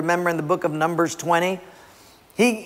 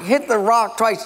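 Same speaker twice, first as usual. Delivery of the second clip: normal talking voice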